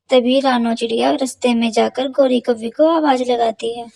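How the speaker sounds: usual speaking voice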